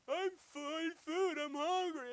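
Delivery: high, loud mock wail; hammed-up hungry beggar's whine; exaggerated whining. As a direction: beg pitifully, stretching the words out. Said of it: "same guy up top that was whining".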